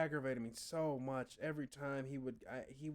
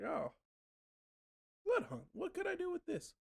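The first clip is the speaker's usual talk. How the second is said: very high, squeaky mock-curious voice; mock wonder. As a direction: squeak it out like a baffled kid. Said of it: "I sat there and was like".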